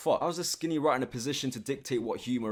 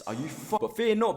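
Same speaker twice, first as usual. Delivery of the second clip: ordinary delivery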